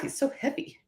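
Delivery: breathless, almost voiceless from lifting; out of breath. Real, not acted. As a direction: gasp it out after hauling something heavy. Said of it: "Man, I'm out of breath".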